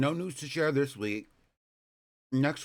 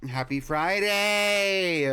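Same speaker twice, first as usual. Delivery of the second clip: drawn-out greeting; 6 semitones higher, 5 dB louder, much slower than usual. Acted.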